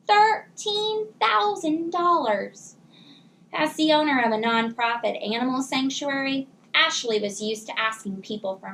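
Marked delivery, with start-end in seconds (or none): 0.1-2.8 shocked exclamation. A narrator's reading. Narrator exclaims at the cost.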